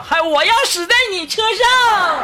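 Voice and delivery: high mock yell through laughter; mock shout. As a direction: echo her cry, laughing. Re-quoting the woman's shout.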